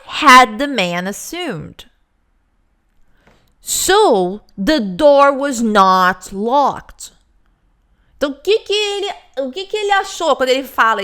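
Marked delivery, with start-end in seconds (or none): 3.6-7.1 "So the door was not locked" slow, with "not" stressed; slow, drawn out, pressing on "not". Shown as a demonstration. Teacher showing the man's emphasis, slow. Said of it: "E ele dá ênfase aqui".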